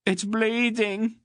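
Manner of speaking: bloodied redhead wailing at Garion. Acted. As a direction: whimper it like a hurt bully. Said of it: "he wailed accusingly".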